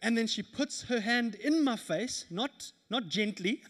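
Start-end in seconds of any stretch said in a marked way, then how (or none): none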